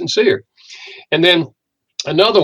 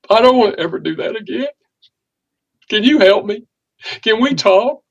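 much higher, pleading as if through tears; retold tearful plea; 7 semitones higher than usual. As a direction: beg her for help, voice near breaking. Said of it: "started crying".

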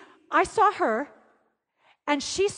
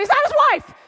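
high, loud excited shout; excited outburst; 10 semitones higher, 8 dB louder, faster than usual. Retold or imitated. Woman scanning a video for the pastor's wife, excited shout. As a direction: blurt it out loud and high, re-enacting the moment.

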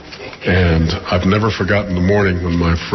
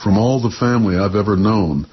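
measured, lyrical poem reading; poetry reading; 3 semitones higher than usual. Acted. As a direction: read it like a poet on stage. Poet reads his poem.

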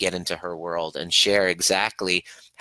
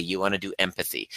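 ordinary delivery

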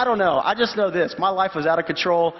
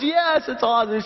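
excited; 6 semitones higher than usual. Retold or imitated. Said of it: "She was so excited".

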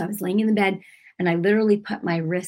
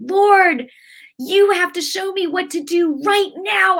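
high, loud pleading prayer; desperate cry to God; 11 semitones higher, 6 dB louder than usual. Retold or imitated. Alone at home in pain, she pleads loudly with God.

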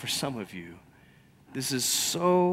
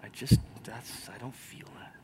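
doubtful murmur; 2 semitones lower, 9 dB quieter than usual. Retold or imitated. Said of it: "you're like".

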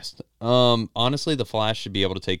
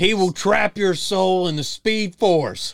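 booming trailer voice; 7 semitones higher, 8 dB louder than usual. Pretend. Trading mock trailer lines.

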